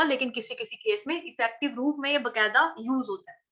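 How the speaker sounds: normal talking voice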